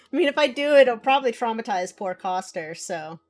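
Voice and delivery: normal talking voice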